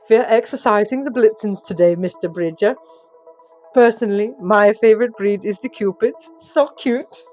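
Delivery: loud, perky elf tour guide; gushing elf. Acted. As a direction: gush over your favorite reindeer. Elf gushes over reindeer.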